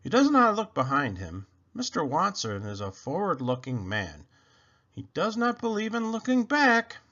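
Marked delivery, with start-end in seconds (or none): none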